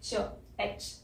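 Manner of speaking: "एच" whispered softly, ch sound at the end; soft breathy "च, एच"; aitch. Shown as a demonstration. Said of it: "एच मतलब कि".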